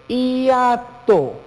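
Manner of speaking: "hiato" drawn out as hi-a-to; syllable split. Shown as a demonstration. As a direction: stretch each syllable of "hiato" very slowly. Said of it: "acá estamos verificando lo que es el". ("hiato" muy lento, sílaba por sílaba)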